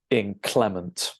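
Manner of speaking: inclement said slowly, stress on its second syllable, CLEM. Shown as a demonstration. Teacher showing learners where the stress falls, inclement stressed on CLEM. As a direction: lean on the middle of inclement: in-CLEM-ent. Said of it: "you stress the second syllable".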